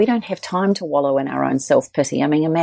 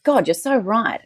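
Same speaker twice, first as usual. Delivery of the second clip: retold realisation; 7 semitones higher than usual. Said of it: "I was like".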